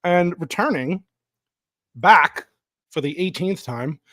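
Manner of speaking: announcer intro. Acted. Host introduces guest.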